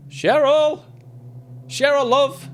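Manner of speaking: put-on high, loud calling in a muddled northern English accent; worried father shouting for his lost daughter, hammed-up accent; vague put-on accent. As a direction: call out for Cheryl in a confused regional accent. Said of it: "I don't even know what accent I was trying to go for".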